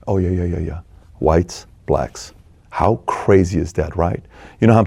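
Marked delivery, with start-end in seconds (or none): 0.1-2.3 hushed mock kids. Kids reverting to please parents.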